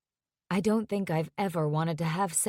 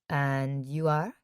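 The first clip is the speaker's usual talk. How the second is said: put-on French accent; 6 semitones lower, slower than usual. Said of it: "she has a French accent".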